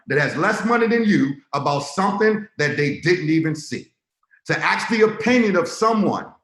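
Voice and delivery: rising hype sermon to the Zoom crowd; fired-up sales pitch. Acted. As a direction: sell it like a revival preacher. Sales pitch preaching at force.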